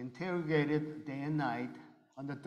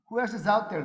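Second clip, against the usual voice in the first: interrogator shouting; 6 semitones higher, 10 dB louder, faster than usual. Retold or imitated. Interrogator questioning him.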